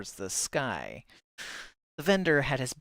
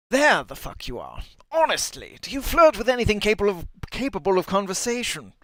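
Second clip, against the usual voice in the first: higher, louder, fast exasperated scolding; exasperated shout; 9 semitones higher, 8 dB louder than usual. Acted. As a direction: yell at him for flirting again. Birdman scolds Kosh.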